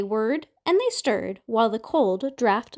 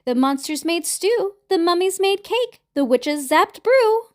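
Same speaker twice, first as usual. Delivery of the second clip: Ma Mummy calling; 7 semitones higher, 4 dB louder than usual. A narrator's reading. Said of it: "called Ma Mummy".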